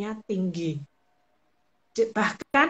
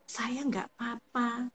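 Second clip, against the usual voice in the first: softer, slower, held-back "Saya nggak apa-apa"; quiet and slow; 2 semitones higher, 7 dB quieter, slower than usual. Shown as a demonstration. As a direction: say it quietly, slowly, as if it's fine. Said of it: "Kalau dengan nada yang misal". (pelan dan lirih)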